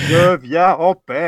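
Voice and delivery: loud, high, slow alien voice. Acted. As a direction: announce peace like a movie alien. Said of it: "Un alien".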